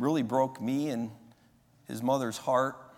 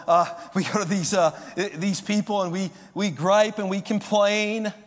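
mock whiny griper; 8 semitones higher, 7 dB louder than usual. Mocking gripers, voice shifted.

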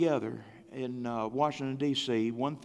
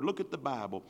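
ordinary talk, nothing marked

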